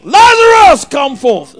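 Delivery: full shout calling Lazarus out; loud voice. Acted. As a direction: call him from the tomb at full voice. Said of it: "and he said with a loud voice".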